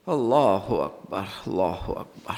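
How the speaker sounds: soft, low, drawn-out exclamation; hushed takbir. Real, not acted. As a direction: slowly sink into a murmur. Preacher's awed exclamation mid-sermon. (دھیمی، ٹھہری ہوئی تکبیر)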